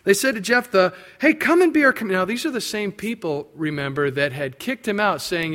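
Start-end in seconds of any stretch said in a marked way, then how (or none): 1.2-2.1 pleading elders. Acted. Elders begging Jephthah back.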